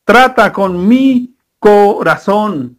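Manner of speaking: "trata" stressed, said very slowly; Señor, trata con mi corazón, prayed slowly; slow earnest prayer. Acted. Prayer to God.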